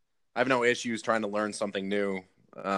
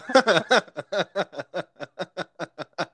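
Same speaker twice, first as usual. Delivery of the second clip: high bursting giggle; giggling laugh; 8 semitones higher, 4 dB louder than usual. Real, not acted. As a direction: break into a giggle. Laughs at pizza question.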